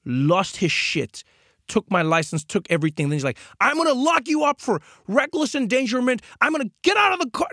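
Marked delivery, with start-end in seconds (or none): 3.6-7.5 very high, frantic mock-furious cop. Cop to driver, threatening arrest, mock furious and high. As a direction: blow up like an unhinged cop. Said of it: "it was now this chaos".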